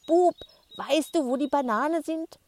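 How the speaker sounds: loud, high call across the street; calling shout. Acted. Grandma shouts up to the boy across the street, loud and high. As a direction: hand cupped, yell up to the window. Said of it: "legt die Hand an den Mund und schreit".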